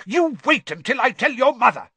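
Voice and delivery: high, loud angry warning; raging threat. A narrator's reading. Father threatening to tell their mother, raging. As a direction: threaten them at the top of your temper. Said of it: "raged Mister Weasley".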